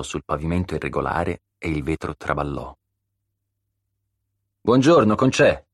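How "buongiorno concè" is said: loud, high, put-on cheery greeting; feigned enthusiasm. A narrator's reading. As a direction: call out hello with simulated enthusiasm. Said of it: "esclamò con simulato entusiasmo".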